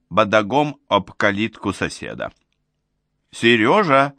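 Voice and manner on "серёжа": raised, higher-pitched schoolmarm call; piercing teacher's call. A narrator's reading. Old neighbour calls for Seryozha at his gate, piercing teacher's call. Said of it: "закричала она пронзительным учительским голосом".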